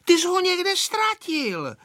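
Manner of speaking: high-pitched accusing cry; shouting girl. A narrator's reading. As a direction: accuse him in a high girlish voice. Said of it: "křičela Aneška na Vojtu". (vyčítavý holčičí křik)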